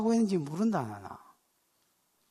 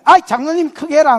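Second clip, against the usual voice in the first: 큰소리 대꾸 흉내; 6 semitones higher, 13 dB louder than usual. Retold or imitated. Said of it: "그래".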